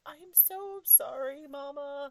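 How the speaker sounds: put-on high, loud, drawn-out kid's apology; slow, pleading little-kid voice; apologetic child imitation. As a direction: mimic a child's wailing apology to mom. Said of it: "come around and be like".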